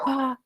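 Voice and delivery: mock breathless gasp; quick, soft high gasp for air; out-of-breath gasp. Shown as a demonstration. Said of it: "if you try to do everything like".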